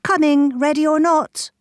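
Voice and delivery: high, loud call across the pond. A narrator's reading. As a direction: call out to the hiding ducklings. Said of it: "the little counting duck called out".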